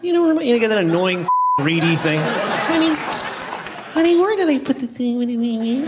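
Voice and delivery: honey voice creeping upward; squeaky couple voice. Shown as a demonstration. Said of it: "and you go".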